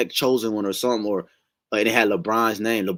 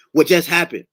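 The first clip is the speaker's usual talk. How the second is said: exasperated outburst, higher and louder; mad; 7 semitones higher, 7 dB louder than usual. Retold or imitated. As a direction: blurt it out in frustration, retelling the dream. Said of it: "I was so mad".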